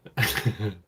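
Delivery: burst of laughter. Real, not acted. Laughs at the mad alien line.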